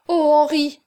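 high, loud exclamation; protestation émue. A narrator's reading. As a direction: cry out his name in protest. Aurore protests to Henri, blushing. (exclamation émue, voix haute)

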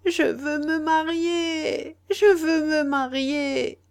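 high, slow tearful pleading; sobbing plea. Acted. Sister begs her family through sobs, high and slow. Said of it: "elle ne cessait de répéter".

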